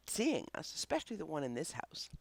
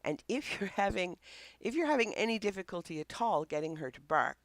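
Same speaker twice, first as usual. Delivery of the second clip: ordinary talk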